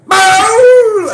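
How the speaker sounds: a sharp barked "Bow!" at full force; one loud shouted syllable; sudden yell. Real, not acted. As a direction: burst out with a loud explosive cry. Ranting threat, sudden yell.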